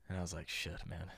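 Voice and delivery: very low, hushed retold reaction; reluctant aside. Telling friends how he declined the trip, reluctant aside.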